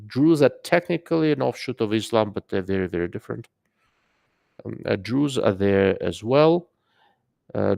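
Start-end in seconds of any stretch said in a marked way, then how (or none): none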